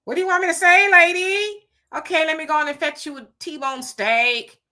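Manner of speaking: high, loud mocking retort to a demanding guest; mock sarcastic reply. Mocking hotel worker to complaining lady.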